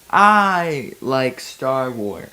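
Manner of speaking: drawn out, deliberately slow. Real, not acted. Drawling her love of Star Wars slowly. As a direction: stretch every word of it out.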